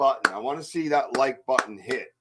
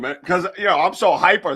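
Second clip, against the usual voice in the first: ordinary talk, nothing marked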